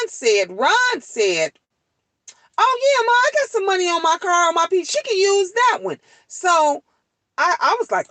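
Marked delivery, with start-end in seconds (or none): 2.6-6.0 higher, quick, helpful son; eager son's voice. Retold or imitated. Son offers his mom his card, eagerly.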